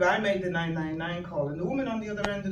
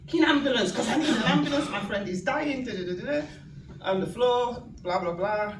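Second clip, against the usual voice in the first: retold irate rant, raised and higher; mildly hammed-up exasperated demand; irate caller; 5 semitones higher, 4 dB louder than usual. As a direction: re-enact an irate emergency caller. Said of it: "I could hear this irate woman".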